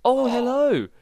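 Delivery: high, loud startled hello; surprised greeting. Real, not acted. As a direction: pitch leaping up at an unexpected greeting. Answers the mob's greeting.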